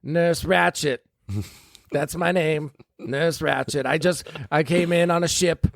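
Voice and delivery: high, fluttery nurse voice, rushing; disguised villain slipping out after the birth; falsetto nurse. Acted. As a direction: gush like an over-sweet fake nurse. Disguised nurse introducing herself.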